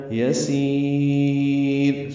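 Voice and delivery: یسیر with the long vowel after the sin held a very long time; lengthened vowel. Shown as a demonstration. Teacher showing students the lengthened vowel, held long. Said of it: "سین کو لمبا کر دیں گے".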